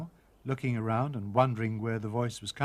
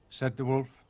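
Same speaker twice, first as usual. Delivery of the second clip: plain reading voice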